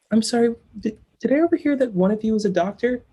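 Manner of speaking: imitated flight attendant. Flight attendant seeking a doctor, imitated polite urgency. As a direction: imitate an attendant tentatively interrupting passengers.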